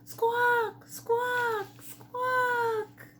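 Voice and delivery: high squawking bird call; pheasant squawk. A narrator's reading. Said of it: "Pheasant called out".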